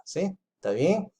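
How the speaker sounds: ordinary talk, nothing marked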